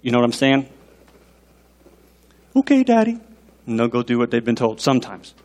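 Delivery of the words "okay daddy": put-on high, meek little-kid reply; child's voice. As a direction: imitate an obedient small child. Voices his obedient child.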